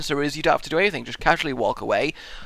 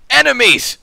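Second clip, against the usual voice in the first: loud, high comic surrender shout; hammed-up battlefield alarm; mock panicked cry; 12 semitones higher, 10 dB louder than usual. Acts the French surrendering.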